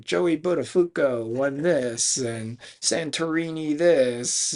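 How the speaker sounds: lightly voiced slow award announcer; banquet announcer. Announcer at an Italian neighborhood banquet, mildly showy.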